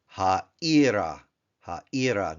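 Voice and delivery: ha'ira, stress on I; teacher modelling ha'ira; Hebrew ha'ira. Shown as a demonstration. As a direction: say ha'ira syllable by syllable. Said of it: "ir for city".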